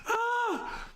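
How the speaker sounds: very high squealing laugh then cry; shrieking laugh. Real, not acted. Streamer shrieks laughing at the ban list. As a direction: burst into a shrill cackle.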